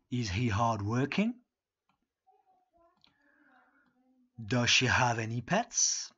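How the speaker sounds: lent, fort et bien articulé, comme un modèle à imiter; détachez chaque question pour que la classe répète; lecture modèle pour répéter. Shown as a demonstration. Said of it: "je lis une fois, et vous répétez après moi". (la prof lit les questions à la classe, lentement et fort)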